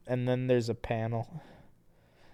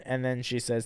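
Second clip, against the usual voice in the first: usual speaking voice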